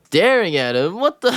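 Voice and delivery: high-pitched, indignant disbelief; incredulous. Real, not acted. Reacting to a mother scolding her son's wife, incredulous. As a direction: throw it back in disbelief.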